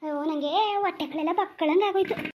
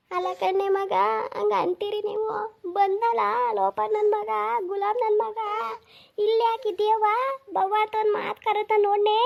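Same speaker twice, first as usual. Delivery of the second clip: put-on higher, drawn-out old woman's plaint; old grandmother lament; 7 semitones higher than usual. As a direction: whine like a hurt old mother. Old mother role, higher voice.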